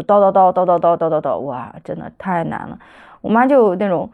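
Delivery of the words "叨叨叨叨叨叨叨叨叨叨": mock nonstop nagging on a monotone; imitated nagging. Daughter mimics mother on her home turf, nagging. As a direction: rattle off an imitation of endless grumbling. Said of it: "我妈就永远都在抱怨".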